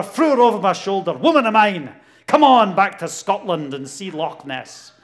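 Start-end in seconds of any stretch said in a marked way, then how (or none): 1.1-4.9 loud hammed-up Scottish brag; loud playful Scotsman bravado; mock Scotsman. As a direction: boom it out like a comic caveman Scot. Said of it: "being a good Scotsman".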